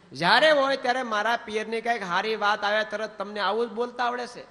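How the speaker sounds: scolding wife. Retold or imitated. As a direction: nag him about her family. Wife scolding husband about her family.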